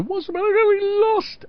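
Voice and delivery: mock high exasperated mum; lightly voiced mother, high and incredulous; a mum puzzled at her crying son; mum's voice. Mum retold after defeat.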